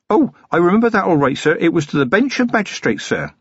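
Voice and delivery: put-on high-pitched, earnest young policeman; eager, deferential and high; eager young constable. A narrator's reading. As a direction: answer readily in a youth's high voice.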